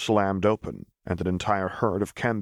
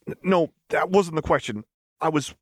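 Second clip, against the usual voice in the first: higher, stammering denial; flustered Echo; 9 semitones higher, 3 dB louder than usual. Acted. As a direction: scramble to correct her.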